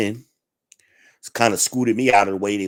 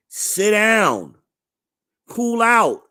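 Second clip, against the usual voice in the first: high, loud mock command to sit; hammed-up order to sit down; mock yell; 11 semitones higher, 4 dB louder, much slower than usual. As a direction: bark it up high at the fidgeter. Yelling at fidgety person.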